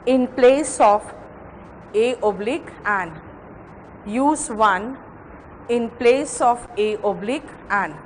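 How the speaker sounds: slow, word by word dictation for notes; dictating notes at a slow pace. Shown as a demonstration. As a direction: spell it out slowly so students can write. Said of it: "लिखो नीचे".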